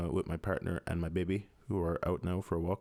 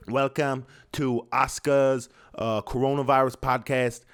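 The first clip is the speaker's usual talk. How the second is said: mock podcast host; 3 semitones higher, 5 dB louder than usual.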